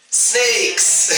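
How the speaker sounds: high, drawn-out mock-dramatic cry; hammy game master's reveal. Game master reveals the predictable snakes.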